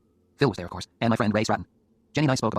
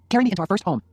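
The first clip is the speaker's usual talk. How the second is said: high, louder playful order; demanding wife; 10 semitones higher, 6 dB louder than usual. Acted. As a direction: order your husband, pitched high. Jenny demands to be carried.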